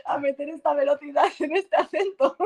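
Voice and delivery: high, laughing mock panic. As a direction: laugh through the panic about the fast lines. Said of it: "es como". (pánico fingido entre risas, agudo)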